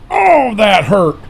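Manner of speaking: high, slow, hammed-up ouch; mock pain. Player mock-wincing at his own in-game crash, high and drawn out. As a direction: pretend it stung.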